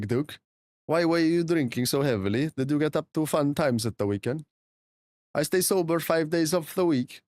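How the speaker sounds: put-on Swedish accent in casual chat. Streamer answering chat as a Swede, Swedish accent. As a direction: keep up the sing-song Swedish English. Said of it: "this is such good Swedish accent".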